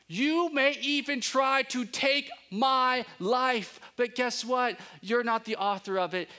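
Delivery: slow, high, defiant declaration; hint of David standing firm; voicing David's defiance. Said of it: "David is saying".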